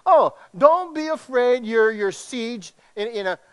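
high, slow, mock soothing with irony; mock reassurance. Preacher to congregation, mockingly reassuring under siege. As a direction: pretend it's all fine, ironically.

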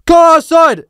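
high, loud bark; mock angry command; imitated shouted order. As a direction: yell it as a challenge. Acted man ordering him outside.

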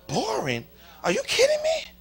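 acted incredulous comeback; indignant disbelief. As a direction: leap high and loud, offended. Acted indignant mother's retort.

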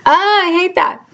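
high laugh through tears, embarrassed; teary laugh. Real, not acted. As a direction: laugh off the crying, really. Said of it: "I just had a little crying spell".

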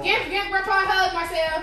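high-pitched, eager urging. Real, not acted. Mother urging her child to hug Grandpa, coaxing. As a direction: pitch it up to nudge a little one.

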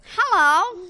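high, loud goofy puppet voice; silly puppet hello on request. Shown as a demonstration. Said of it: "Do the puppet again".

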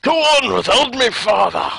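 high, loud melodramatic plea; heroic sacrifice. Pretend. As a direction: ham up a dying farewell.

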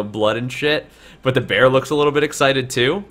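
ordinary talk, nothing marked

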